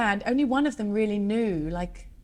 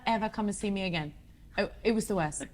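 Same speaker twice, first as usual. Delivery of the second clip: ordinary talk, nothing marked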